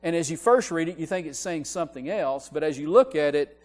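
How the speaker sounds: high, loud, emphatic preacher voice; impassioned preaching. Real, not acted. Preaching with rising intensity.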